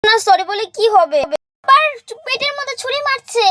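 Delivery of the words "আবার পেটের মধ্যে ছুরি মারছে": pained cry. Acted. Doll stabbing her in game.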